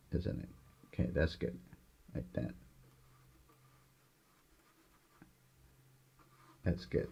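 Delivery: soft murmur to himself; half-whispered while painting; gentle painting instructor. Real, not acted.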